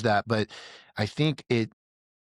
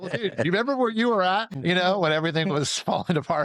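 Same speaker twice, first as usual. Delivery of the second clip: mocking high self-reminder; 8 semitones higher than usual. Mock self-talk reminding of past.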